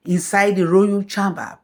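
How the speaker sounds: ordinary delivery, nothing marked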